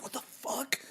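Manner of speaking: high, breathless mock shock; incredulous, airy high-pitched outburst; retold disbelief. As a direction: squeak it out in astonishment, breaking into laughter. Retold shock at British accent.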